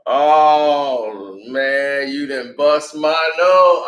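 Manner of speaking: long, drawn-out moan; mock pained wail. Friend reacting to his busted nose, mock drawn-out wail. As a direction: drag every word out in hurt disbelief.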